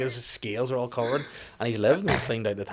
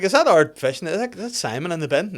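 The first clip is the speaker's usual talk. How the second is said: put-on kids asking; 7 semitones higher, 7 dB louder than usual. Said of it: "the kids are like".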